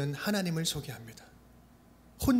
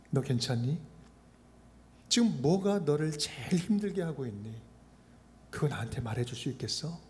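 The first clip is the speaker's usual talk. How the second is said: soft, lower, hushed concern; quiet asking; 4 semitones lower, 5 dB quieter than usual. Pretend. God quietly asking His hurting child, soft and tender. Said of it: "조용히 물으시는 거예요".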